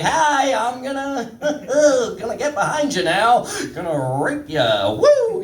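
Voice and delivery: high, giggly, harmless voice; friendly guy voice. Acted. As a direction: chirp it like a hopelessly mild neighbor. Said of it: "like, for me, I'm like".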